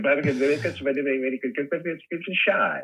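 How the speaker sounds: high, sing-song babble; Stooges nonsense. Retold or imitated. Doing the Stooges routine, a high nonsense babble. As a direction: rattle off the nonsense in a silly high voice. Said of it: "And he would go".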